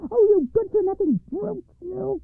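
high, furious scolding cry; angry cry. Acted. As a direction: shriek it at the brute. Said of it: "cried she".

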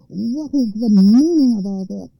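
loud, angry troll outcry; troll crying out. Acted. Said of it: "he cried to the king".

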